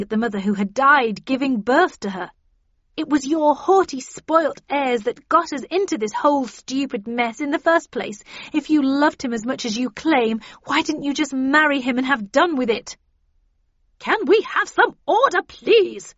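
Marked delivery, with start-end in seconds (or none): 3.0-13.0 high, loud accusing rant; indignant young woman, voice raised; defiant. A narrator's reading. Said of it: "replied the younger Catherine defiantly". 14.0-16.1 very high, loud, swooping call to order; shrieky matron demanding order; yelled. A narrator's reading. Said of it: "yelled Miss Havisham so loudly".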